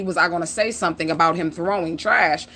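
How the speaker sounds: usual speaking voice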